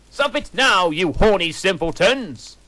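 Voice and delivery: high, loud scolding shout. A narrator's reading. Professor yells at the two students, loud and shrill. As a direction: scold them at full blast. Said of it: "shouted Professor McGoggle".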